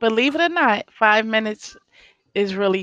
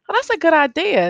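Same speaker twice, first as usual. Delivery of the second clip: bright approving quote, higher; 7 semitones higher, faster than usual. Retold or imitated. Quoting a colleague who likes the rage room, approving. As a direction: chirp it like a colleague who loves the idea. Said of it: "some people are like".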